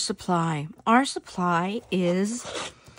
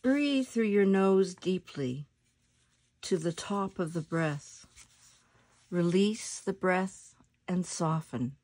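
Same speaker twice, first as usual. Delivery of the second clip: soft, unhurried meditation voice with long pauses; guided meditation; 4 dB quieter than usual. Acted. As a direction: guide them gently into their breath, letting phrases settle softly.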